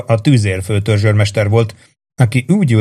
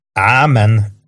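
plain reading voice

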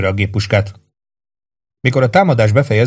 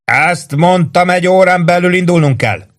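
shouting; 8 semitones higher, 3 dB louder than usual. A narrator's reading. Lieutenant shouting the order again to the roof.

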